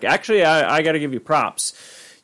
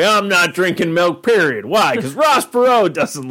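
raised, emphatic parody of a headline reader; mock indignation; 6 semitones higher than usual. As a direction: declare it with hammed-up stubbornness. Mocks headline readers refusing milk.

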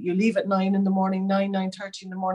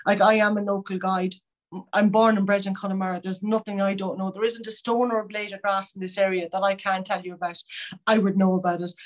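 natural Connemara Irish lilt; a local Galway guide's own west of Ireland accent. Real, not acted. Connemara native describing local knowledge.

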